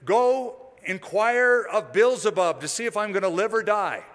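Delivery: a hint of an urgent royal order; king's command. King sending men to inquire of Beelzebub, lightly acted command. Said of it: "the king said".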